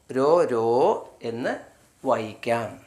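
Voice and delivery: ordinary delivery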